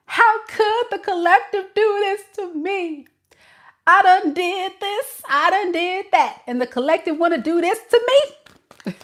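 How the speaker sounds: high, whining mock of a hurt person; whiny child. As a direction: whine it like a spoiled child. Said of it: "whining and complaining like a child".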